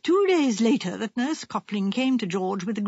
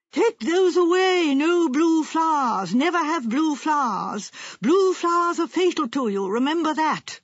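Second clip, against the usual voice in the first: crying out a warning; 6 semitones higher, 4 dB louder than usual. Acted. Wife quoting the fortune teller's warning about flowers, crying out. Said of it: "She pointed at them and cried out".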